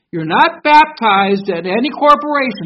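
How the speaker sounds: "You're not baptized at any corporation" proclaimed loud, every word stressed; emphatic proclamation. Shown as a demonstration. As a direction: announce it like a banner in the sky. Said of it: "I put emphasis on it".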